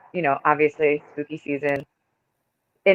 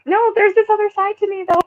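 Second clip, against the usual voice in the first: high voice; faster than usual. Retold or imitated. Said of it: "So it's like".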